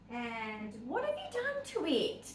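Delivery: high, slow, fond telling-off; pet-voice reproach; mock scolding. Real, not acted. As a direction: scold the puppy playfully. Scolding the dog fondly.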